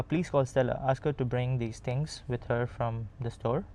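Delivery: ordinary delivery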